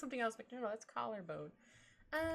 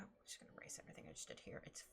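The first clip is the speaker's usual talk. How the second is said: whisper; 13 dB quieter, much faster than usual. Real, not acted. Muttering to herself while drawing.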